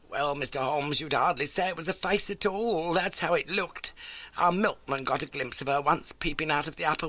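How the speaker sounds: a lightly voiced elderly landlady; homely, eager old lodging-house keeper. A narrator's reading. Landlady greets Holmes, lightly voiced homely.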